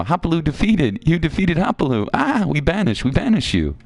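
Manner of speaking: high-pitched creature outcry; alarmed little creatures. Acted. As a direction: squeal it like panicked critters. Voicing panicking game creatures.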